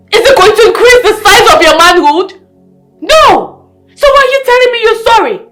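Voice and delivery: loud, fast angry tirade; furious berating. Acted. Wife berating her apologising husband, furious.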